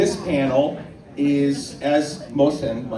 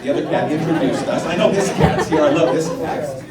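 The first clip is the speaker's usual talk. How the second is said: laughing while talking about the cat; amused aside about the cat on stage; 3 semitones higher, 5 dB louder than usual. Real, not acted. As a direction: chuckle through it. Laughing over cat joke.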